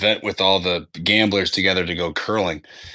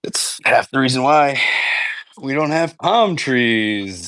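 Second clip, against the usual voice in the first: deadpan punchline; 4 semitones higher, slower than usual. Acted. Joke punchline, drawn out.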